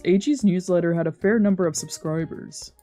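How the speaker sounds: ordinary delivery, nothing marked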